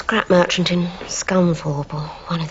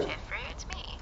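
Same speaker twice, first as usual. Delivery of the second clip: whisper; 18 dB quieter than usual. Real, not acted. Secret phone call.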